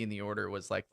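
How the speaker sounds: usual speaking voice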